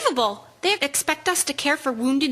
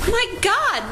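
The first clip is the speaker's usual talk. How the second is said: higher, startled exclamation; shocked gasp of a cry; 6 semitones higher than usual. Acted. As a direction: blurt it out in horror. Nurse sees the bag moving.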